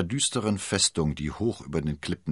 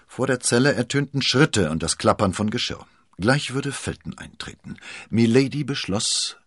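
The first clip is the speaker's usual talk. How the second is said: usual speaking voice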